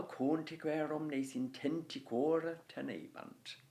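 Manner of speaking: reads the line out in scansion rhythm; scanned reading. Shown as a demonstration. Teacher reading the scanned line aloud for pupils. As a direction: chant the scanned Latin line. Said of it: "so there we are".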